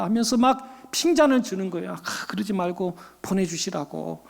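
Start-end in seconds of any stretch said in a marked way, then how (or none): 1.9-4.2 soft, coaxing retold plea; softly voiced entreaty; pleading. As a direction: beg gently as the waiter. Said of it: "종업원이 사정사정해서".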